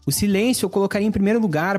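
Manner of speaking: ordinary delivery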